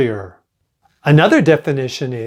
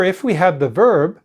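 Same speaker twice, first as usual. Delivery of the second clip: usual speaking voice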